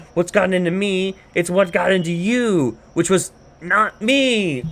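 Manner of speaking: high, mock-outraged comeback; indignant retort. Man quarreling with the woman, high indignant retort.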